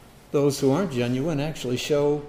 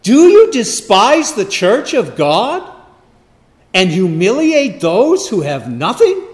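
loud, higher, slow rebuke; emphatic rebuke; 6 semitones higher, 6 dB louder, slower than usual. Acted. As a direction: thunder it at the congregation slowly. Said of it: "very emphatically".

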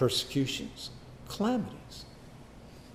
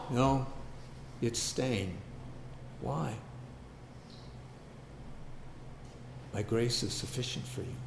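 soft, lower and firm; quiet firm answer; 5 semitones lower, 11 dB quieter than usual. Pretend. God gently refusing the believer's demand, quiet and firm.

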